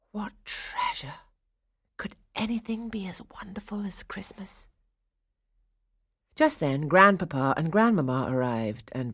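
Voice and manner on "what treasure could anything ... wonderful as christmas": high, hushed whisper of wonder; dreamy. A narrator's reading. Clara gazing at the giant Christmas tree, dreamy whisper. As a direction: breathe it softly, lost in wonder. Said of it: "Clara thought dreamily".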